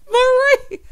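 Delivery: loud, very high cry of the name; high, loud exclamation; high squeal. Real, not acted. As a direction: squeal it out after cracking up. Exclaims name after laughing fit.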